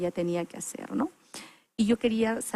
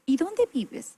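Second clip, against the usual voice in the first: imitating the sister; 8 semitones higher, much slower than usual.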